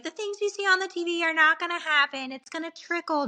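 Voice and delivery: mock instructor. Birthing class instructor quoted.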